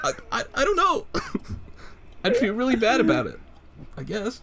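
high, squeaky mock reply; high squeaky answer.

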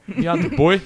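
put-on loud playful Jamaican accent. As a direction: Jamaican accent, starting with meont. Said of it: "if you wanna do a Jamaican accent".

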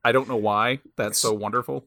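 usual speaking voice

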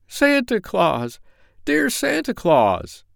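high, slow, sobbing cry for help; crying out with a sob. Acted. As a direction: call out desperately through a sob. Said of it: "cried Jimmy Scarecrow with a great sob".

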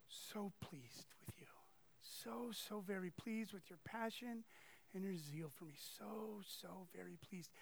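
soft, breathy whisper of a loving father; hushed tender whisper. Retold or imitated. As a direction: whisper it close and gently, lingering. Preacher voices God's gentle word.

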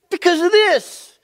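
very high, loud and slow; angry teen retort. Retold or imitated. Son yelling at dad, very high loud slow retort. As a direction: fling it back at dad. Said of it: "It was great".

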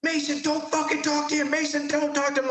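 high, harsh mock yell of a sergeant; imitated yelling. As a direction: bark it like an angry squad leader. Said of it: "Verdura's yelling at me".

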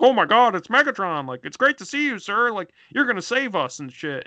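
high, loud, starstruck squeal; mock excited underling voice; gushing fanboy minions. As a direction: gush like a minion meeting his idol. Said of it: "hapless Decepticons are like".

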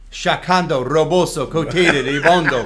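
loud, ecstatic tongues, ending in a laugh; retelling his tongues to Doctor Barkley, ecstatic and loud; speaking in tongues. Retold or imitated. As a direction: burst out in tongues, overcome. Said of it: "all I could do was".

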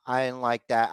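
ordinary talk, nothing marked

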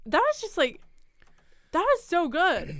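excited. Real, not acted. Praising the story.

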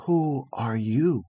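soft, slow, awed near-whisper; trembling awed half whisper. A narrator's reading. Frightened boy asks the strange girl who she is, awed half whisper. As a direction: breathe the question in frightened awe. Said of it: "in a trembling, awed voice and a half whisper".

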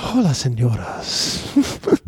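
very slow, breathy Spanish come-on; breathy would-be ladies' man; Tim Kaine. Acted. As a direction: draw it out, mock-sultry and breathy. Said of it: "why are you so breathy now".

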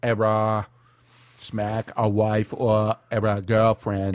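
slow, put-on New England voice; lightly mocking New England accent with drawn-out vowels. Comic parodying the clip with stammered fillers, slow New England accent. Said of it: "there's New England".

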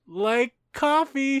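high, slow, drawn-out reply; hesitant, put-on high pitch; awkward mock answer. Acted reluctant answer to maid.

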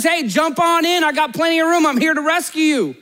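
high-pitched retold calling out; imitating a rescuer's high holler; mock scream. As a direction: call out like a rescuer, without a full scream. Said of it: "he screams out".

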